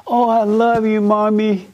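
high, mock little boy gushing to his mommy; childlike voice. Mocking a grown son cooing to his mother in a childish voice. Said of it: "A grown man turned into a child".

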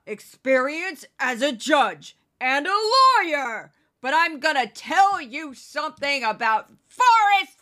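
slow, loud, lecturing TV-judge rant; Judge Jeanine impression. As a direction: hammer every word like a scolding judge. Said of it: "that's Judge Jeanine for you".

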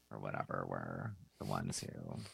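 hoarse smoker's voice; rough, raspy throat from smoking. Real, not acted. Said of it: "It's also just my raspy voice 'cause I've been smoking too much".

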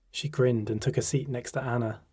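usual speaking voice

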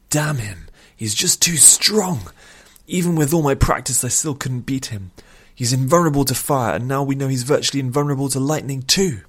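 high, loud, angry frustration; frustrated outburst. A narrator's reading. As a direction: rage at an enemy you couldn't beat. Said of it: "cried out with frustration".

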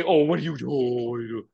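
exaggerated sing-song mock coo; talking down to kids. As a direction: mimic someone fussing over a small child. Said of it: "we don't talk like that to him".

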